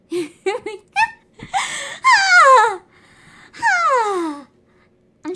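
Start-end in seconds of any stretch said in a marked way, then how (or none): 2.0-4.5 a loud, high "Ah!" of pure frustration; frustrated scream. Real, not acted. Streamer venting at the stream, frustrated scream. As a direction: let out a sharp yell of frustration. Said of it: "trying to, like, calm down and breathe".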